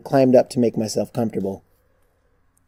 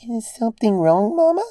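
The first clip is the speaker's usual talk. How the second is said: put-on high child's voice, unsure; a small child asking anxiously; timid little girl; worried little filly; 11 semitones higher than usual. A narrator's reading. Child sensing trouble.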